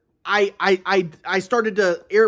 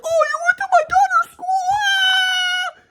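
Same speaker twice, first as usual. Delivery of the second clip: much higher, crying wail ending in a shriek; mock hysterical wail; slower than usual. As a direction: overdo her catastrophizing with a sobbing cry. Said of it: "She's starting to fucking catastrophize".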